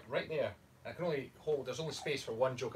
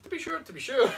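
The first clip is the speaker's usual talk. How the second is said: high, louder Irish catchphrase; mock Irish accent; 12 semitones higher, 6 dB louder than usual. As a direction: cheerily overdo the Irish lilt. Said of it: "say it with an Irish accent".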